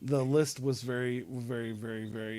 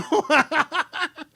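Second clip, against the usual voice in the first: high laugh; 12 semitones higher, 4 dB louder than usual. Real, not acted. Laughing at the joke.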